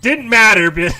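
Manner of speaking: loud, high hype call; laughing at the failed play; excited. Real, not acted. Hype over a failed lineup.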